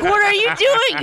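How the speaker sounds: high, loud outraged cry; playfully scandalized shout; mock outrage. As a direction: yell at him in mock horror. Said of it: "I'm like".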